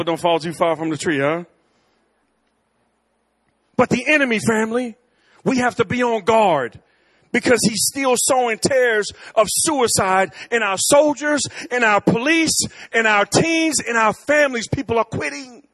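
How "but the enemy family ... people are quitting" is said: high, loud full-force preaching. Acted. Pastor warning his church family, preaching at full force. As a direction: warn the church with rising fervor.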